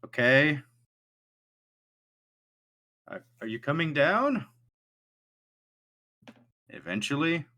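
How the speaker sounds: high, hesitant and nervous; a jittery guy stalling awkwardly; nervous NPC voice. Acted.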